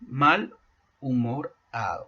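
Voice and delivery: mal and humorado said apart, with a pause between; "mal" then "humorado" as two separate words; split into its parts. Shown as a demonstration. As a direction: break mal from humorado to show the pieces. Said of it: "Otro ejemplo".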